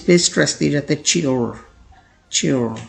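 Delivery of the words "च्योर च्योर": च्योर said slowly and clearly, twice; careful pronunciation. Shown as a demonstration. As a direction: model the word च्योर for learners. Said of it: "pronunciation word demo". (शब्द का साफ़ उच्चारण)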